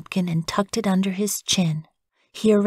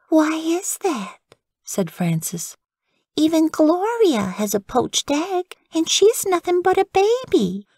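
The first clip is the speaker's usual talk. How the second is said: put-on high, childlike indignation; sulky child's protest; indignant little girl; 10 semitones higher than usual. Frances protests her missing egg, high and childlike.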